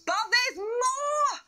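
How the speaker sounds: high, slow, shouted build to the big news; hyped-up reveal. Acted. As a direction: sell it like a game-show host. Host hypes bigger cash prizes.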